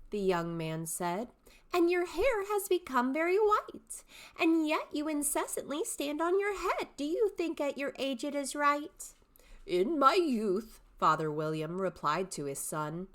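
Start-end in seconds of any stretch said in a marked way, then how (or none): 1.7-9.1 high, bright-eyed questioning youth; young man's voice. Shown as a demonstration. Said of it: "three distinct character voices". 9.6-10.8 put-on slow, deliberate old man; old father. Shown as a demonstration. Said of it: "three distinct character voices".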